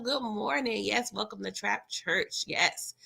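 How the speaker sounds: ordinary delivery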